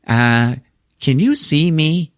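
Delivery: high, slow, drawn-out ghostly question; eerie, wondering ghost act; spooky older lady. Retold or imitated. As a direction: play a lady who thinks she's a ghost. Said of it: "older blonde lady, and she's like".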